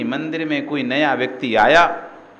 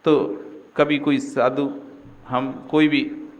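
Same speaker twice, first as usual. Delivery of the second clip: normal talking voice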